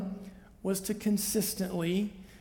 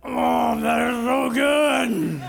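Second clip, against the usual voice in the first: delighted savoring; 5 dB louder, much slower than usual. Pretend. Mock savoring a tasty bite.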